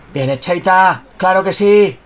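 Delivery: normal talking voice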